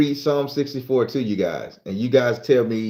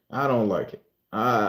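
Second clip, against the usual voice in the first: ordinary talk, nothing marked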